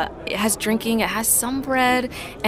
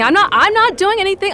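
higher, louder, fast denial; defensive; 11 semitones higher, 6 dB louder, faster than usual. Acted. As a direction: protest too much. Caught drinking, defensive.

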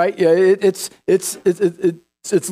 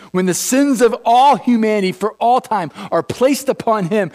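normal talking voice

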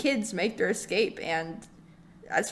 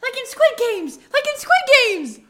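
squealing eager outburst; excited high shout; 11 semitones higher, 6 dB louder than usual. Acted. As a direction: blurt it high and loud.

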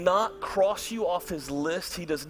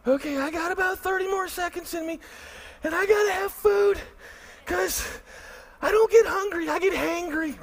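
high, far put-on voice, near whining; high mock-hangry whine; hangry pastor; 13 semitones higher than usual. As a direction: whine like a starving, cranky man. Pastor voicing his tired hungry self.

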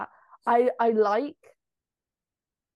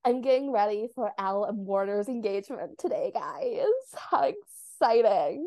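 put-on Valley Girl accent. Shown as a demonstration. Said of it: "pretty good Valley Girl accent".

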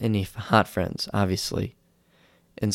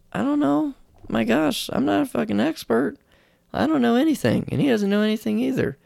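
high, flustered mock panic; panicked admission; 13 semitones higher than usual. Asked about Blue Mountain Haze, high flustered mock panic. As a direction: cave in, higher and flustered. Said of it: "and then I'm like".

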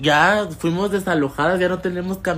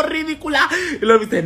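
laughing; 11 semitones higher than usual. Real, not acted. Teasing mom, laughing.

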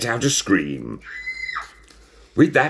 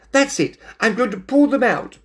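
high, fed-up cry; exasperated outburst; 4 dB louder than usual. A narrator's reading. Fed-up cat threatens his own whiskers, exasperated. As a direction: burst out in high-pitched frustration.

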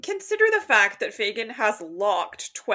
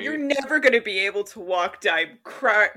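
slow dramatic reading; 3 dB louder, slower than usual. Acted. Reads villain line slowly.

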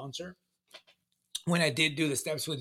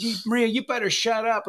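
higher, louder threat to a sister; raised voice telling her to shut up; angry warning; 8 semitones higher, 7 dB louder than usual. Retold or imitated. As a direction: warn her off like an angry kid brother. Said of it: "I said".

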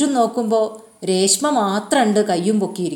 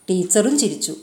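ordinary delivery, nothing marked

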